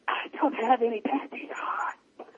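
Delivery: slow, drawn-out mock whisper; imitated seductive whisper. Friend quoting Boomerang's seduction line, slow whisper.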